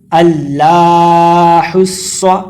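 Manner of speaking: slow, drawn-out tajweed chant; slow chanted Arabic recitation; Quranic recitation. Shown as a demonstration. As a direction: recite it melodically and unhurried. Recites after spelling.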